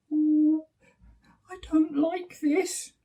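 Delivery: high little scared bear voice; a small cub afraid of the dark; scared Baby Bear. Acted. Baby Bear frightened in the gloomy forest, high and small. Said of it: "whispered Baby Bear".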